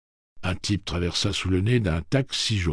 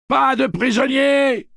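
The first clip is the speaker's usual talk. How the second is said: slow, much higher, loud yell; 11 dB louder, slower than usual. Acted. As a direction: draw the war cry out. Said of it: "hurle Roland".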